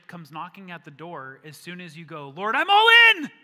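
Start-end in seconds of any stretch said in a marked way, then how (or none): 2.3-3.3 high, full-out yell to the heavens; all-out high-pitched cry of commitment; exuberant shout. Acted. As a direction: shout it out like a zealous new convert. Acts eager convert crying out.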